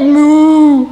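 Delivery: long, loud imitated moo; cow's moo. A narrator's reading. Cow in a children's story, long loud moo. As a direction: hold a loud, steady moo. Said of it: "Cow said".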